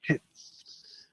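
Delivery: quiet whispered verdict. Real, not acted. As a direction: breathe the words, no voice. Whispered result.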